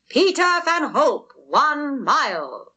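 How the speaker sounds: slow, loud announcement; crier's call. A narrator's reading. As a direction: call it out to the crowd. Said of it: "calls the crier".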